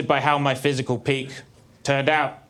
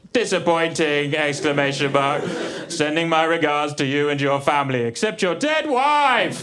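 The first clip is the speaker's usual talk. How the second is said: mock drafting aloud. Acts Keith dictating a first draft.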